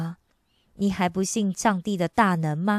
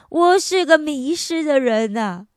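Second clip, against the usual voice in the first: a touch of a lost man's wail, raised; crying out; 6 semitones higher, 4 dB louder than usual. Retold or imitated. 重病的哥哥在会后放声大哭，带着哭腔喊出. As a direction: cry it out as the dying brother. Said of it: "他放声大哭".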